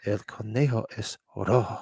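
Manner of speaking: soft, low Spanish murmur, imitating; little voice. Host quoting the little voice to co-host, hushed. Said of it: "the little voice is like".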